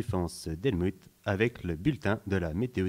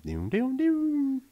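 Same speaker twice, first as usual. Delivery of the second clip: rising startup sound; much slower than usual. Acted. Restart sound.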